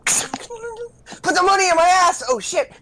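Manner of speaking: high, loud laughing shriek; laughing scream. Pretend. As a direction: shriek it while cracking up. Joke robber line, laughing scream.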